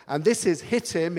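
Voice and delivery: ordinary talk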